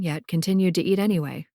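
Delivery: ordinary delivery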